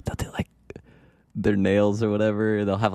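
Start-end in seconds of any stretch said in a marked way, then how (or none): 0.0-0.5 hushed whisper. Real, not acted. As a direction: whisper it quietly. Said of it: "Why are we whispering?".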